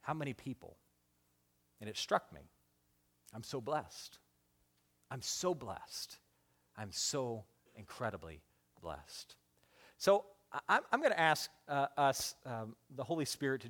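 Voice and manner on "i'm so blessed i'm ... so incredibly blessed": very soft, low and slow, deeply moved; sincere whisper-soft. Real, not acted. Preacher struck by how blessed he is, very soft and slow. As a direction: let it fall almost silent, in awe.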